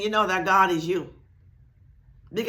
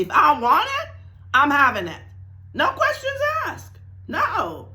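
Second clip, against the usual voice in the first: high laughing "No questions asked"; certain excitement; 7 semitones higher than usual. Shown as a demonstration. Teacher acting out complete faith for students. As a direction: sound utterly sure, almost laughing. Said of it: "acts out sure faith".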